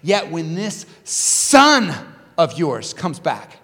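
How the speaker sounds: resentful complaint, loud on the accusations; angry older brother. Acted. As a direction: protest to your father, building in indignation. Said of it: "the older brother was angry".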